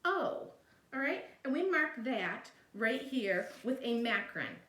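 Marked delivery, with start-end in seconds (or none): 0.0-0.5 "O" said high as a long oh; long vowel sound. Shown as a demonstration. Teacher showing the opened syllable's vowel, high demonstrated long "O". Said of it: "saying its long vowel sound".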